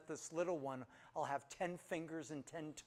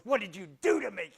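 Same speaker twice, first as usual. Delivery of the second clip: loud, higher mock accusation; mock accusing wife; 5 semitones higher, 11 dB louder than usual. Wife turning on her husband, loud mock blame. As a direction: throw the blame at him, hammed-up.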